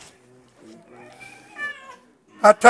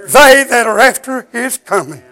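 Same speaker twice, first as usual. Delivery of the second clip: usual speaking voice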